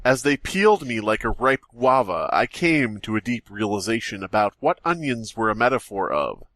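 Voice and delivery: usual speaking voice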